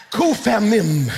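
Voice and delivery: usual speaking voice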